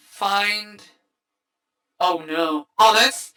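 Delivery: normal talking voice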